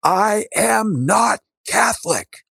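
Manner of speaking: high pitched yell, stretched slowly; shouting it out at the bishop; retold yell. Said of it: "I yelled at the top of my lungs".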